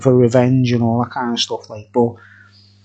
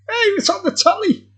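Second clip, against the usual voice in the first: higher, put-on impression voice; Mickey Tomlinson. Still doing Mickey Tomlinson for the camera, high and put-on. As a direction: keep the high borrowed voice. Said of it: "Mickey Tomlinson impersonation".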